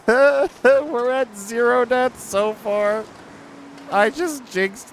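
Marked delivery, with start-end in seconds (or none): 0.0-3.1 laughing. Real, not acted. Jinxing himself, laughing.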